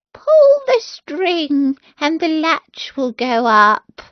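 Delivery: a sick grandmother calling from bed, slow and high; lightly voiced old grandmother, slow.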